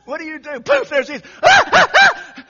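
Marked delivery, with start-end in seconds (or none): 1.4-2.4 shrieking high through laughter; laughing scream. Retold or imitated. Preacher mimicking terrified disciples with a laughing shriek. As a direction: squeal like a scared little girl, laughing. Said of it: "screaming like a little girl".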